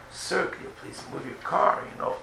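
soft, whispered, gentle asking; hushed and courteous, a hint of pleading; polite request. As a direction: ask him kindly and softly. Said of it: "talk edel, talk nice".

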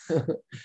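light chuckle after the punchline; brief real laugh; amused laugh. Real, not acted.

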